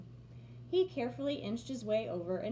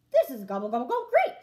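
gobble it out with delight; excited gobble; 10 dB louder, much faster than usual. A narrator's reading. Turkey thrilled by the Easter egg sign, excited gobbling. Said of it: "said Turkey".

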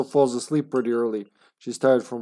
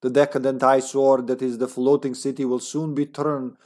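put-on comrade Russian accent. Agent's journal about a final plan, Russian accent. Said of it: "a comrade accent".

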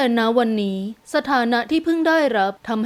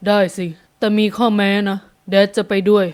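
low-pitched man's voice; agree, with a condition, as Dad; father's voice; 4 semitones lower than usual. A narrator's reading. Father agreeing if he comes along, low male voice.

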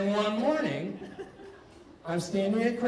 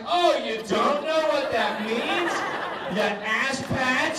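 higher, slow sneering taunt; mock indignation; 8 semitones higher, slower than usual. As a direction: taunt him like he should know. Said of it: "And I'm like".

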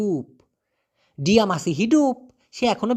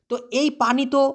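normal talking voice

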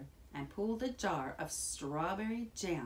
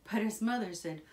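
ordinary delivery

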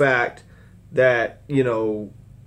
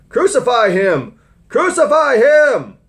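slow shout building much higher and louder; shouting crowd; 13 semitones higher, 9 dB louder, slower than usual. Acted. Preacher voicing the mob's cry to crucify, building shout. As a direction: yell it like an angry mob. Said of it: "But they shouted".

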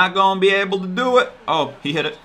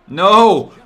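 loud no; 4 semitones higher, 9 dB louder than usual. Real, not acted. Reacting to a bad play.

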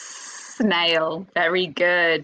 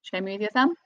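usual speaking voice

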